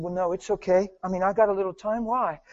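soft, easygoing answer; low-key, unhurried reassurance; gentle reply. Retold or imitated. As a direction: answer the shy stranger softly. Reassuring a nervous stranger, acted out.

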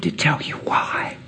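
whisper. Retold or imitated. Confiding to the arrested boy.

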